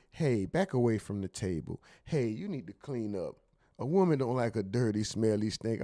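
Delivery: the soft-spoken way he refuses to use; a hint of timid nagging; soft gentle pleading. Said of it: "I'm not gonna say".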